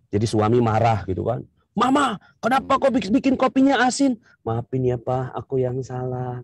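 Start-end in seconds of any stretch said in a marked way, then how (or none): 1.7-4.2 high, peeved husband voice; husband griping at his wife about the coffee; complaining husband. Acted. Husband scolding wife over coffee. 4.4-6.4 meek apology. Acted. Wife apologizing to husband.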